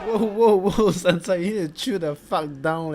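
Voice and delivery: talking back loudly to Sansa on screen; loud laughing whoa. Real, not acted. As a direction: call out to Sansa through a laugh. Reacting to Sansa in the clip.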